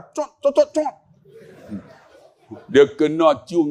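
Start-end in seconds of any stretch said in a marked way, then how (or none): 0.0-0.9 quick, higher smacking kiss sounds; kissing noises. Retold or imitated. Son-in-law kissing his father-in-law's cheek, imitated kiss noises. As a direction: imitate a run of pecks on the cheek. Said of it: "saya cium pipi dia".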